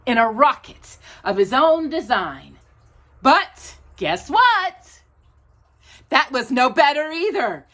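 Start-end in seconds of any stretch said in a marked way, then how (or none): 3.2-5.0 slow, high teasing question; suspense. A narrator's reading. Storyteller teasing a child before the twist, slow and high. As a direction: draw it out to hook the child.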